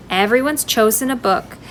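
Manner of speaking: usual speaking voice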